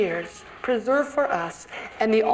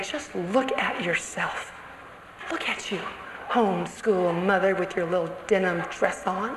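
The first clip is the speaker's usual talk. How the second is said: hushed contemptuous voice in the ear; accusing inner voice; 5 semitones lower, 6 dB quieter than usual. Retold or imitated. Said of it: "a voice in your ear saying".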